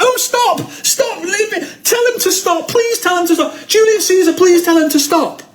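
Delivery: high, laughing whimper of a beaten gladiator; hammed-up high begging for Caesar to stop; mock pleading. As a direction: beg for mercy, hammed up. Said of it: "unless you're like".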